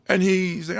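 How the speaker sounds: normal talking voice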